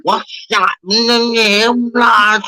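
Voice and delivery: slow, drawn-out mock Scouse; imitating a Liverpool lad; Scouse impression. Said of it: "people are from the building go".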